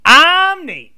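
loud, high self-introduction; big swooping host intro; show-opening announcer. Real, not acted. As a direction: holler your name to open the show.

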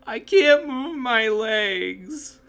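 loud, high, drawn-out mock wail; child's wailing scream. Child answering stepmom from the couch, in a loud mock wail. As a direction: cry it out slowly, like a hammed-up helpless kid. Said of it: "in good old fashion ... I just said back to her".

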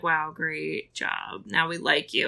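soft, slow, underplayed congratulations; low-energy mock approval; mock praise. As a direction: imitate fickle kids with quiet, drawn-out praise. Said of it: "everyone's like".

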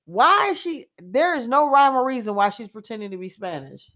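confused reaction to the news, rising high; baffled. Retold or imitated. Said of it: "I'm like".

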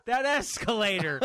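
slow, loud and high warning; stern shout. Retold or imitated. Warning the kid across the way, slow loud scolding. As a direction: drag it out, scolding with a laugh. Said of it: "I go".

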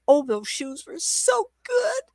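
very high, slow, whining rapture; squealed, drawn-out doggy longing; salivating dog's voice. Acted. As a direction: swoon like a dog drooling over shoes. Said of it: "that dog could just salivate thinking".

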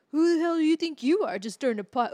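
razzing her loudly like the gang at the dairy; mocking friends. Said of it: "and they're like".

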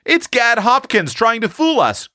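put-on high, indignant boy's outcry; exasperated boy, pitched high; disgusted cry. A narrator's reading. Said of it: "cried Eph, much disgusted".

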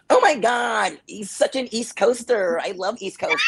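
high, loud gushing mock voice; gushing casting agent. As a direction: squeal it out, mocking an over-excited fan. Mimics gushing casting people.

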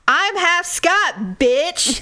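slow, drawn-out yell. Retold or imitated. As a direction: yell it out, dragging each word. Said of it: "yelling out on a terrace".

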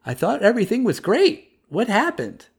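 high, puzzled and innocent; innocently confused. Shown as a demonstration. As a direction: ask it like a bewildered boy. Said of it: "but just like".

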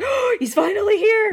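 high, gasping mock excitement; hyped-up imitation. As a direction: imitate a thrilled fan, pitch shooting way up. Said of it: "'Cause you got hyped".

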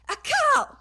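loud yelped sneeze that sounds like a word; sister's sneeze. Retold or imitated. Imitating her sister's loud sneeze for a friend. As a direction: belt out a sudden barking sneeze. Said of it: "my sister sneezes like this".

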